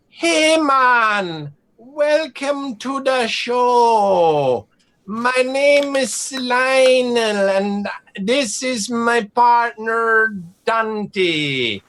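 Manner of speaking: put-on high, slow showman greeting from a boy; eager kid showman. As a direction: roll it out like a tiny ringmaster. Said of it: "he goes".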